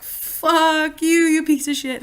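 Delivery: high, loud angry swearing; furious shout. Retold or imitated. Daughter curses out her father on the phone, furious shout. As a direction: spit it at him and hang up.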